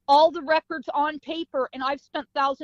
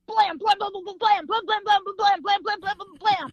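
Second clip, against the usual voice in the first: rapid high-pitched volley of blams; imitated gunfire; 7 semitones higher than usual. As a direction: rattle off gunshot noises without a break. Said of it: "All I could hear behind me was gunfire".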